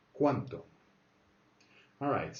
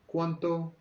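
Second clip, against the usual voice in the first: ¿Cuánto? pitched up high; rising question tune; 7 semitones higher, 4 dB louder than usual. Shown as a demonstration. Teacher demonstrating the question tune, rising pitch. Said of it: "Goes up".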